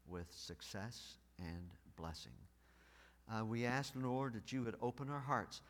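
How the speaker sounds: soft, low prayer voice; hushed pastoral prayer. Real, not acted. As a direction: pray quietly and humbly. Pastor praying before sermon.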